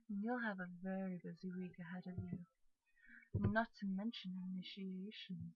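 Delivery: soft, lightly voiced girly-guy character. Brother sending kids off before orientation, softly voiced girly guy. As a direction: keep it quiet, lightly in character. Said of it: "like, a girly guy".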